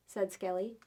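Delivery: ordinary delivery, nothing marked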